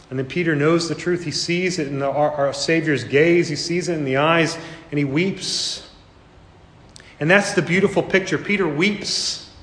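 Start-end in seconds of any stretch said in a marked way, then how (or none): none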